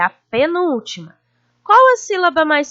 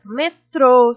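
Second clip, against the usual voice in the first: metrô with a louder, held final trô; stressed final syllable; 5 dB louder than usual. Shown as a demonstration. Teacher giving an example of oxytones, stressed final syllable in metrô. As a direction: lean on the last syllable of metrô. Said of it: "a sílaba mais forte a gente se demora".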